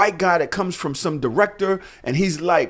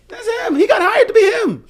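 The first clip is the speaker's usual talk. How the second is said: laughing disbelief; 6 dB louder than usual. Real, not acted. Laughing disbelief about the role.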